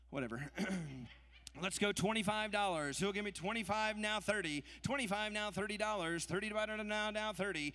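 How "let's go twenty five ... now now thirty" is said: rapid rhythmic bid chant; auctioneer. Acted. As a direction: rattle off the numbers in a rolling chant. Live charity auction bid calling.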